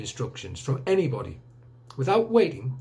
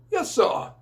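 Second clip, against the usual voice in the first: much higher, distant military reply; old soldier answering; 4 dB louder than usual. Acted. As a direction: snap back a keen yes sir from far off. Major answers from the ground.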